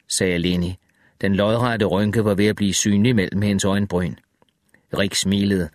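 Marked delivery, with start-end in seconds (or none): none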